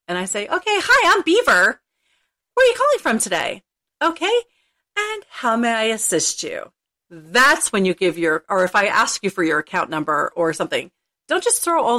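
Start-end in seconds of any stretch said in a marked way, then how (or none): 0.5-6.7 customer service voice. Retold or imitated. Agent greeting a caller.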